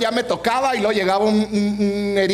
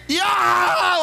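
sharp retold yelp of pain; pained cry; 8 semitones higher than usual. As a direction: cry out as the nose snaps back. Le empujan la nariz rota. (grito de dolor al enderezarle la nariz)

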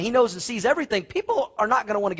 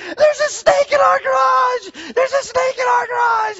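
hammed-up high-pitched shriek, drawn out and loud; mock-hysterical scream of alarm; imitating panicked wife; 8 dB louder, slower than usual. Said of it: "she comes running in, and she goes".

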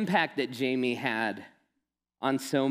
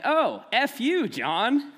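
mock cheeky jab; 10 semitones higher, 4 dB louder, slower than usual. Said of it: "she's like".

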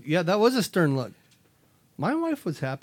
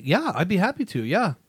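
mock cheerful agreement; 5 semitones higher, 4 dB louder than usual. Mock eager yes to kids.

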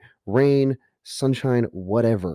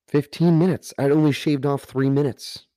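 stunned disbelief; 5 semitones higher than usual. Retold or imitated. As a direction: repeat "Fifteen minutes" in shocked disbelief. Stunned repeat of the time.

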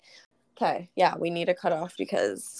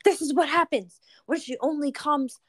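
retold rant, higher pitched; mock indignant ranting; ranting friend; 6 semitones higher than usual. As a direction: imitate a worked-up friend's rant. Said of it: "she was going on a rant".

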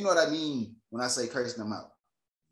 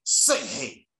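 retold mother's order; 5 dB louder, slower than usual. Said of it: "His mom said".

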